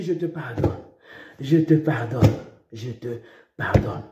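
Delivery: soft, low, gentle pardon; whisper-soft reassurance to the penitent; tender forgiveness. Retold or imitated. Preacher recalling forgiving a sorry man, low and soft.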